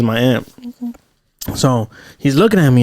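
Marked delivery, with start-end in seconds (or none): none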